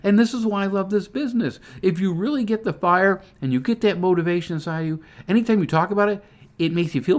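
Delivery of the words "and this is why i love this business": a high, bright burst of delight; excited exclamation. Retold or imitated. Recalling a great talk with a prospect, an excited high exclamation. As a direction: let it jump up with joy. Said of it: "I was like".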